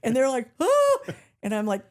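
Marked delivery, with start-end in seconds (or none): 0.6-1.0 high, loud startled gasp; a sudden shocked oh. Retold or imitated. Strangers in car react to her standing up, high startled gasp.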